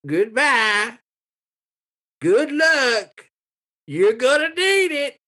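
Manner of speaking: put-on high-pitched old man seeing them off; old townsman, farewell. Old man sends players off.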